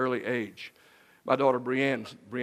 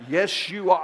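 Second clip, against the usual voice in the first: higher, louder wife voice; insistent wife; 6 semitones higher, 6 dB louder, slower than usual. Retold or imitated. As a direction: overrule him flatly. Wife insists on new wallet.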